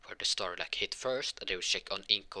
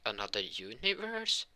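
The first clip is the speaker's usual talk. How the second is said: puzzled, rising echo of the news; incredulous question; 2 semitones higher than usual. Acted. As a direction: question it in disbelief, sweeping upward. Characters question surprising news.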